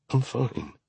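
high, soft, tearful whisper; broken whisper. A narrator's reading. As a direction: whisper it as if holding back sobs. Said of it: "she whispers brokenly".

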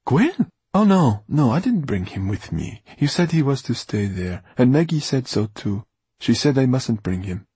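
high, loud, over-innocent surprise; squeaked question into hurried denial; feigned surprise. A narrator's reading. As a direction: protest your innocence a bit too hard. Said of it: "Farid did his best to sound as surprised as possible".

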